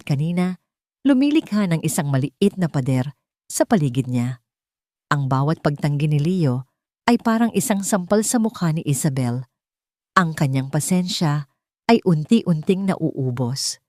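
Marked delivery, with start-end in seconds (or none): none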